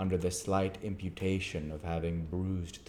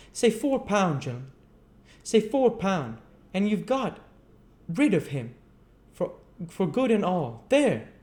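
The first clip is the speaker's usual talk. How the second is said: much higher, loud rough bargaining; haggling chimney sweep; 13 semitones higher, 7 dB louder than usual. Acted. Gamfield haggles with the board, high and pushy. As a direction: push the deal with a final flourish. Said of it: "said Gamfield".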